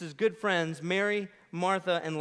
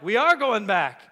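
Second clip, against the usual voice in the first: much louder, pumped-up resolve; eager declaration; 3 semitones higher, 9 dB louder than usual. Acted. Disciples answering Jesus, fired up and louder. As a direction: shout it like a rallying team.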